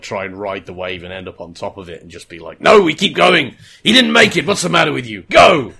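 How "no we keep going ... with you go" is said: imitated barking order. Acting Dodgson bossing King.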